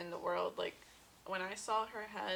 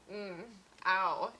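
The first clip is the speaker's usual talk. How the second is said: ordinary talk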